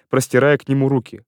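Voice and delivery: ordinary delivery, nothing marked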